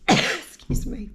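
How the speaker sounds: coughing, choked up; choking. Real, not acted. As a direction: clear a choked throat. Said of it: "I'm just choking myself".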